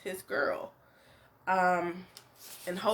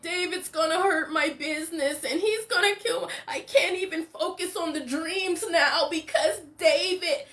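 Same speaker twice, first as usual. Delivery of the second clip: high, whiny voice with a fake sob; mock whining and crying; 7 semitones higher than usual. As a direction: mimic a crybaby complaining, teary and pitched up. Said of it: "All this whining and crying".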